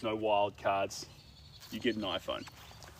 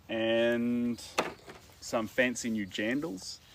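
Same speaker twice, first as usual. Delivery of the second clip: normal talking voice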